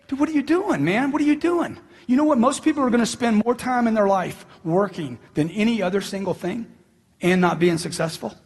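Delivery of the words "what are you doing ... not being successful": ordinary talk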